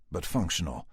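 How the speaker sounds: ordinary delivery, nothing marked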